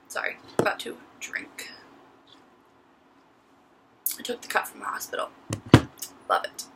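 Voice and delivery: whispered and fast; whispered aside. Real, not acted. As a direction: hush it out as a quick aside. Aside while drinking.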